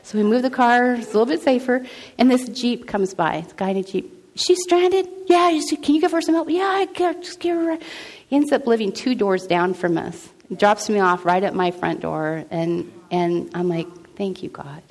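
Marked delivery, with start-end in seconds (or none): 4.3-7.8 high-pitched acted roadside exchange; calling between cars. Passing driver and helper discussing a stranded woman, acted calling out. 14.2-14.8 soft, whispered prayer; whispered thanks. Retold or imitated. Woman thanking God at her front door, whispered. Retold private prayer of relief.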